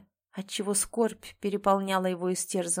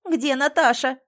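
louder, higher, voice cracking with worry; voice breaking; 4 semitones higher, 6 dB louder than usual. A narrator's reading. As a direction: let the voice break from anxiety. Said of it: "Срывающийся голос Валентины выдавал невероятное волнение".

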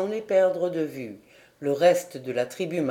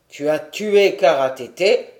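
stern chief; 3 semitones lower, 6 dB louder than usual. A narrator's reading.

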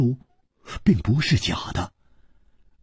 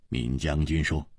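ordinary delivery

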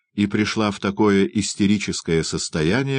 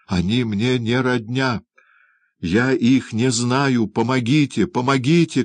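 higher, urgent, drawn-out protest; crying for help; 5 semitones higher than usual. A narrator's reading. Oliver struggling in a man's grip, crying out. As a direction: plead desperately for rescue. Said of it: "крикнул Оливер".

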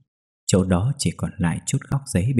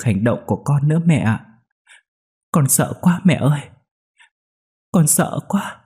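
tearful; 5 semitones higher than usual. Acted. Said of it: "Cô Hiền cũng khóc".

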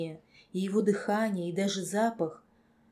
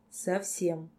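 plain reading voice

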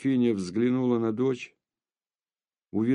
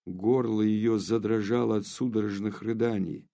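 ordinary delivery

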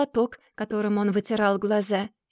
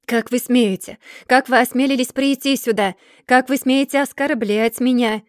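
louder, indignant rebuke; indignant outrage; 3 semitones higher, 5 dB louder than usual. Acted. Mr. Dorrit confronts his unwelcome visitor, indignantly outraged. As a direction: demand an answer, outraged and loud.

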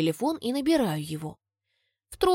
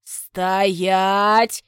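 growled order; 6 dB louder than usual. A narrator's reading. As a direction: growl it like a stern father. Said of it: "рыкает отец".

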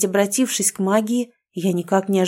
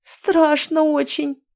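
frightened; 7 semitones higher, much slower than usual. A narrator's reading. Frightened heroine on the path.

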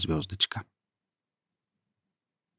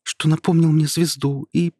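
excited; 7 semitones higher than usual. Acted. Boy excited about the ball.

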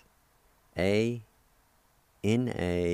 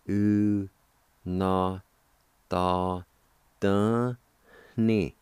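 syllable by syllable; 4 dB louder, much slower than usual. Shown as a demonstration. Teacher shows the second part of kindred, syllable by syllable. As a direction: separate held syllables, louder on the last ones. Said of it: "And it's pronounced like this".

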